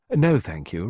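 usual speaking voice

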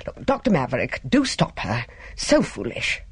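urgent protest without raising the voice; exclaiming. A narrator's reading. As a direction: appeal to the doctor in an understated exclamation. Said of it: "Miss Belliver exclaimed".

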